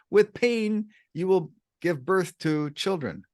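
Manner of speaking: high-pitched, emphatic quoted decree; "With pain" lifted high for emphasis; emphatic repetition. Retold or imitated. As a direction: say it again to drive it home, pitched high. Voicing God repeating for emphasis.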